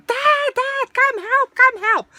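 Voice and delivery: high-pitched cry for help; retold scream. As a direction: imitate a frightened girl yelling for Dad. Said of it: "screaming one night".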